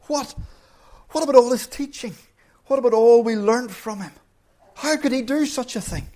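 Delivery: high, fast, pained questioning; 1 anguished disbelief. Retold or imitated. Preacher reliving his shock at a hero's fall, anguished. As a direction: voice the shock of a betrayed listener.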